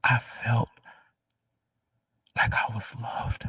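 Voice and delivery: fighting through tears, half whispered; crying. Real, not acted. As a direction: overcome and crying as she testifies. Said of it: "I'm crying".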